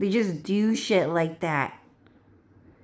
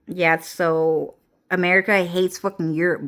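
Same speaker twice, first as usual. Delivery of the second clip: usual speaking voice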